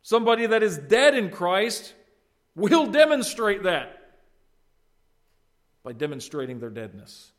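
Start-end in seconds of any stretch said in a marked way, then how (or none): 0.0-4.2 loud, high, drawn-out proclamation; emphatic pulpit declaration; forceful preaching. Real, not acted. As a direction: preach it out with full projection.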